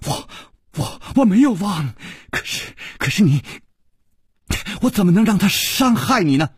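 high, stammering protest; flustered high-pitched pleading; stamping, distressed. A narrator's reading. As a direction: stammer anxiously, then rush out the plea. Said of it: "柳无眉跺了跺脚说".

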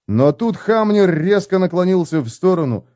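ordinary delivery

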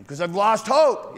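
the complainer admits losing hope; mock complainer. Complainer answers.

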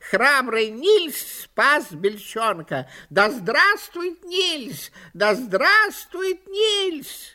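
high, slow triumphant magpie chatter. A narrator's reading. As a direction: cheer it like a shrill magpie. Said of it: "застрекотала ещё громче".

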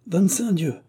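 a hearty oath, pitched high; exclaiming. A narrator's reading. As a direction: swear it out with glee. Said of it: "s'écria Dufay". (Dufay lance son juron avec entrain)

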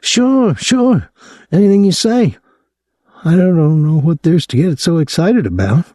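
put-on high, excitable young man's voice; a gawking kid, pitched high; young Claude, leering. A narrator's reading. Claude placating.

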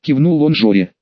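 plain reading voice

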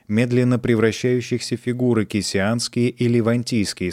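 ordinary delivery, nothing marked

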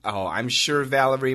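ordinary talk

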